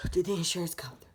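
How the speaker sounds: gasping the words out on almost no air; breathless, whispered question on the phone. Retold or imitated. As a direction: whisper it like you can barely breathe. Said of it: "barely breathing".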